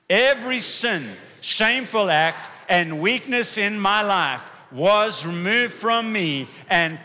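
loud, slow, high battle declaration; like at war. Acted. Pastor leading the church to declare freedom, shouting slowly. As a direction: declare it like a warrior. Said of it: "say this like you're at war".

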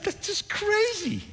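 very high, drawn-out dismissal; retold incredulous woman. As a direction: stretch it out high, imitating her disbelief. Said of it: "She said".

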